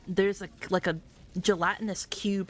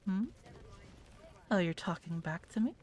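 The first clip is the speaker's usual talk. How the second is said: mommy voice; 8 dB quieter than usual. Shown as a demonstration. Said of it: "you can go, like, stuff like".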